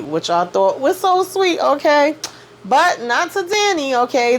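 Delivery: high, slow and emphatic, each okay pushed up; a knowing, dramatic aside to viewers; sassy commentary. Real, not acted. Host telling viewers Danny was unmoved, high and sassy. Host sassing about the flower.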